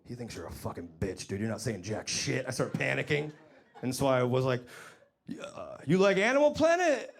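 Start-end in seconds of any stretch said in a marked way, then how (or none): 0.1-2.5 inner voice. Retold or imitated. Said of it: "In my head, I go". 5.3-7.1 nervous squeak. Retold or imitated. Nervous question to Tyson.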